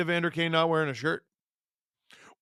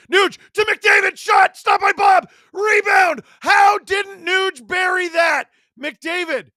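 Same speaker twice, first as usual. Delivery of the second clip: excited shout; 11 semitones higher, 8 dB louder than usual. Real, not acted. Live play-by-play, shot and rebound.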